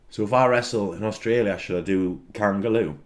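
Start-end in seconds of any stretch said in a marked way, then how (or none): none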